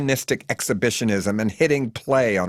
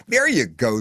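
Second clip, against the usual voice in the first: ordinary delivery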